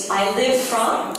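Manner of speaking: slow, with a laugh; slow call for the group to repeat; slow lead line. Shown as a demonstration. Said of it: "say it together now".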